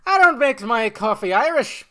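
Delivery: loud, high, hammed-up Irish accent; Irish accent attempt. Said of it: "There you go, something like that".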